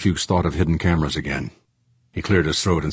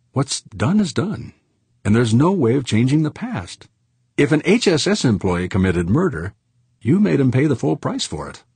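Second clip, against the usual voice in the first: higher-pitched, composed woman's voice; smooth things over in a raised pitch; smooth businesswoman; 8 semitones higher than usual. Acted. Executive closing the argument, high and composed.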